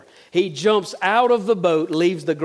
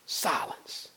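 breathy whisper, much softer; whispered silence; 4 semitones lower, 13 dB quieter than usual. Shown as a demonstration. The preacher describes Peter's wordless breakfast, whispered. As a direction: let the word fall into hush.

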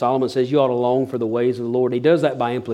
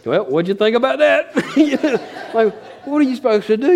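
higher, laughing through it; laughing question; 7 semitones higher than usual. Real, not acted. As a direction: giggle while asking his wife. Asking wife, laughing.

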